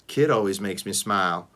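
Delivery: plain reading voice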